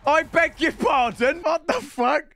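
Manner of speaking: high, laughing, astonished exclaim; stunned disbelief. Real, not acted. Gamer reacting to a lucky pack pull, laughing disbelief. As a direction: gasp it out in delighted shock.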